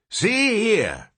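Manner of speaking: put-on high, sudden old man's call; announced. Old newborn to both, sudden announcement. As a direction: announce it crankily. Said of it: "the old man announced suddenly".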